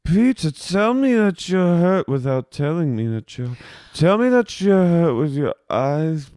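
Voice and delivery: high, mock-intense coaxing; put-on pleading boss. Boss begging bartender to admit hurt, mock-intense coaxing. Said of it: "She goes".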